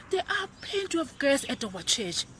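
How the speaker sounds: normal talking voice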